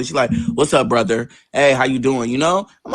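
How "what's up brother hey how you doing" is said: low, offhand greeting; nonchalant. Retold or imitated. Sister opening the door to brother, nonchalant. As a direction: toss it off low, like it's no big deal. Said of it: "she opened the door real nonchalantly".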